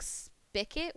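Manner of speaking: spigot said as "spicket"; regional pronunciation. Shown as a demonstration. Streamer recalling local lingo, spigot as "spicket". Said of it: "the way we would say".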